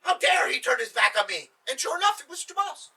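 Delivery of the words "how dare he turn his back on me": angry royal command. Acted. Sultan orders man seized.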